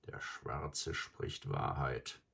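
low, slow, soft wolf growl; growling to himself. A narrator's reading. Graubruder grudgingly admits the truth, growling to himself. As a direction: grumble it low and drawn out. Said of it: "Dann graulte er vor sich hin".

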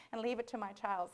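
usual speaking voice

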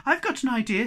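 excited cry. Acted. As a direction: burst out with a bright idea. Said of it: "he cried".

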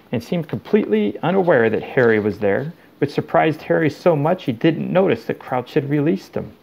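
plain reading voice

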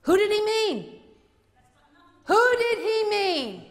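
loud, high indignant question; indignant demand. Real, not acted. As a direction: demand an answer, outraged. Demanding an answer from opponent.

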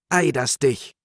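high, loud outburst as the wife; indignant woman's cry; cried out. A narrator's reading. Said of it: "schrie die Frau".

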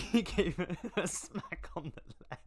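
can't keep a straight face reading this line; laughing while reading. Real, not acted.

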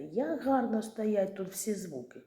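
usual speaking voice

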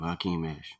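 ordinary delivery, nothing marked

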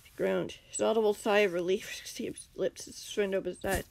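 ordinary delivery, nothing marked

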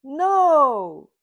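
loud firm refusal. A narrator's reading. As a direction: say no loudly. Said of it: "says Kitty".

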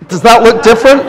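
high, loud delighted exclaim; excited. Real, not acted. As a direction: burst out with amazement. Delighted at demo result.

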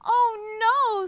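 high, loud cry of dismay; scream. A narrator's reading. Mole and Bear seeing the kite blown away, screaming. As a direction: cry out in alarm. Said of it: "screamed Mole and Bear".

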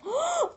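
quick, high-pitched gasp; sudden gasp. Real, not acted. Guesser hearing the blue dress clue, sudden gasp. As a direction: gasp as an idea strikes.

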